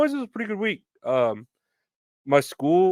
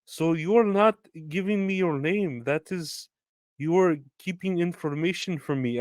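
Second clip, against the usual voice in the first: higher, accusing caller voice; suspicious customer; 6 semitones higher than usual. Retold or imitated. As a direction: play her as prim and suspicious, pitched up. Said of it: "she's like".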